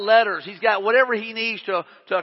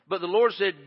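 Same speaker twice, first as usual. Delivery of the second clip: normal talking voice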